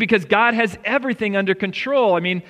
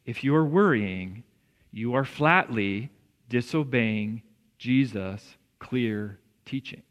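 soft, careful rebuke from the pastor; tender but honest correction; gently; 7 semitones lower, 6 dB quieter than usual. Real, not acted. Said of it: "I want to say this very gently".

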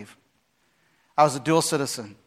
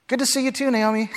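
dry mock-pleasant, much higher; sarcastic; 7 semitones higher than usual. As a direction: tease her with fake politeness. Sarcastic reply to Naomi.

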